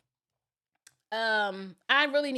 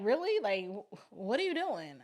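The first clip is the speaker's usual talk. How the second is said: husband's puzzled question; 4 dB quieter than usual. Retold or imitated. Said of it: "He's just like".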